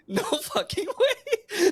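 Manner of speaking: very high, slow, laughing incredulity; retold disbelief. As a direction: squeak it out slowly through a laugh. Retelling disbelief at injury, laughing.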